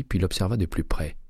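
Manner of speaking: ordinary delivery, nothing marked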